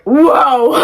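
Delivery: loud, high shocked whoa; a loud, high-pitched whoa of disbelief. Retold or imitated. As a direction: react like you can't believe it. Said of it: "I was freaking out".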